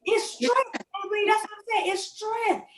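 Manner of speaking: high, emphatic testimony; lifted, preaching emphasis. Real, not acted. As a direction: drive home that it's strength. Emphatic agreement, pitch high.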